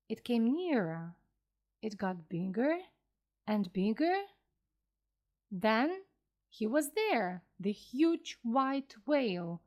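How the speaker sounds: plain reading voice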